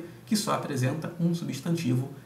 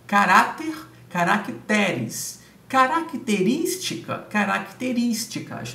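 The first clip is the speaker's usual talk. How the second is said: "Caráter" loud on -RÁ-, "caracteres" slow on -TE-, "Característica" high on -RÍS-; stress position shown; 4 semitones higher, 3 dB louder, slower than usual. Shown as a demonstration. Teacher to exam students, showing how each plural keeps or moves the stress. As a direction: spell out each stressed syllable slowly. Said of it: "Não houve deslocamento".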